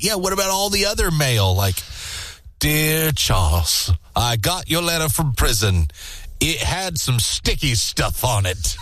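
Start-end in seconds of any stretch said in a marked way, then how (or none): 2.6-8.8 mock German accent. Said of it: "I can't do a good German accent".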